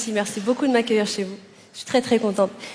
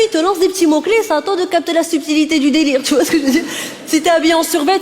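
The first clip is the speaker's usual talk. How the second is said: usual speaking voice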